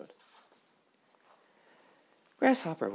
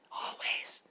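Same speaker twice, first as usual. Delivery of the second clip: whispered always. Acted. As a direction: breathe the word always like a tiny butterfly. Butterflies whisper, Grasshopper loud.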